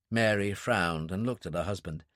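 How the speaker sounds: ordinary delivery, nothing marked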